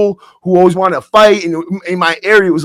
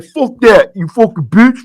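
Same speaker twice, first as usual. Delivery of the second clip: retold heckle, pitched up; raised-voice jeer, half-shouted; imitated yell; 4 semitones higher than usual. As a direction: act out someone calling out an insult. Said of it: "again, he's like".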